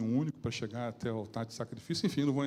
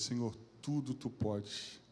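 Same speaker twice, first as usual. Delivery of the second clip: devagar e baixinho, reverente; reverência; 2 semitones lower, 8 dB quieter, slower than usual. Real, not acted. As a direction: diga com reverência contida. Citando a oração com reverência. (pregador citando a oração, baixo e reverente)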